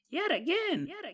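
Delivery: high eerie echoing demon voice; ghostly layered effect voice; creepy high voice. Pretend. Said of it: "creepy extra voices".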